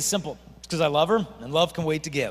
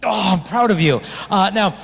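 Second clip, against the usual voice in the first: louder, quicker teasing question; loud joking; 3 semitones higher, 8 dB louder than usual. Real, not acted. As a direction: tease the room loudly. Joking question to teen audience.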